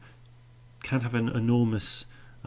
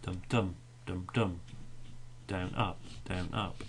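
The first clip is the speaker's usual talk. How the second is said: "dum, dum" in measured pairs, the second beat stressed; beating out the meter; 2 semitones lower, 5 dB quieter than usual. Shown as a demonstration. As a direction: tap out "down, up" as weak then strong. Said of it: "you can actually hear it".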